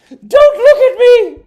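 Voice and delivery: very high, loud, slow shriek; shrieking mock panic. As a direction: squeal it out in a hammed-up panic. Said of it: "would have to be like".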